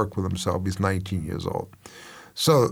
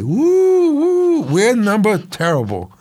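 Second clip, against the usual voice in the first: high, loud fake whooping; mock cheer; 12 semitones higher, 7 dB louder, slower than usual. Fan mockingly cheers the Knicks' worst record, much higher and louder whoop. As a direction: cheer like a delirious fan celebrating being worst.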